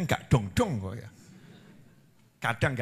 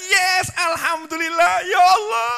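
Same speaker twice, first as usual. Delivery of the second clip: jubilant, much higher and louder cry of thanks; joyful shout; 13 semitones higher, 6 dB louder than usual. Pretend. A worshipper overjoyed at Rajab, shouting thanks. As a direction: shout it up to heaven with delight. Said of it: "seharusnya kita".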